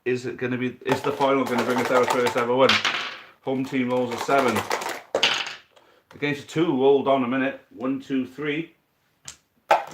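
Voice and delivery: brisk sports commentator calling the match; live play-by-play. Acted. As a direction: call every roll like a match commentator. Live dice football match commentary.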